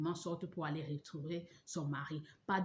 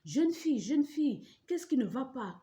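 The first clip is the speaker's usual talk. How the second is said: lightly voiced neighbours; 7 semitones higher, 5 dB louder than usual. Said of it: "les gens ont demandé".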